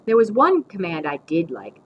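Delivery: plain reading voice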